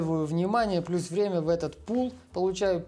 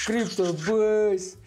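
higher, louder, very slow call; radio call sign; 4 semitones higher, 5 dB louder, much slower than usual. Pretend. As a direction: answer like a radio operator.

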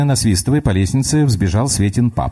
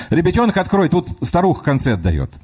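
hearty workman; 10 semitones higher than usual. Acted. Plumber calls to the child behind the door, hearty. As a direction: call through the door like a jolly workman.

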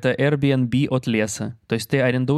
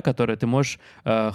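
normal talking voice

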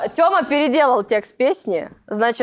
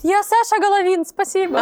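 put-on higher, eager child introducing himself; little boy voice; 5 semitones higher than usual. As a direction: play a shy kid at an audition. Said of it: "и мальчик выходит".

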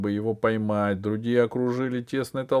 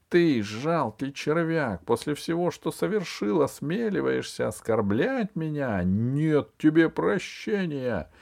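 scornful anger; 7 semitones higher than usual. A narrator's reading. Empress scorns the usurper.

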